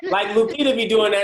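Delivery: mock exasperated call-out. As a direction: bark it like a fed-up friend. Retold scolding of Lupita.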